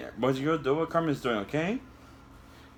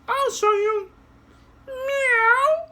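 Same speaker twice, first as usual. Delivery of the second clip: cute meow; 5 dB louder, much slower than usual. Acted. Puppet cat meow.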